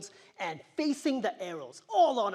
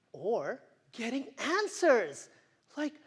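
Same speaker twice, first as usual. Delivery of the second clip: ordinary delivery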